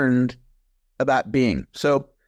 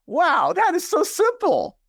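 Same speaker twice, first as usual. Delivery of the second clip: much higher and slow, wide-eyed wonder; mock amazement; 13 semitones higher, slower than usual. Guest retelling his own dawning realization to the host, in mock amazement. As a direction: replay the moment it dawned on him.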